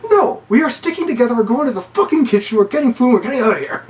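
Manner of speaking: high-pitched, insistent refusal; hammed-up bossy outburst; mock exasperation. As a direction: lay down the law in a comic panic. Acted refusal to split up.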